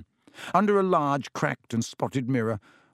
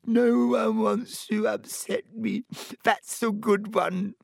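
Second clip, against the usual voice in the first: high, slow wail of self-pity; howling; 9 semitones higher, slower than usual. A narrator's reading. Myrtle throws Hermione's words back, howling. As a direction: howl it back in hurt scorn. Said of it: "howled Myrtle".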